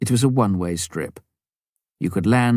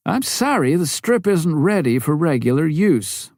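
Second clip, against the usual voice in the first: apologetic; 8 semitones higher than usual. Acted. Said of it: "Bob told him".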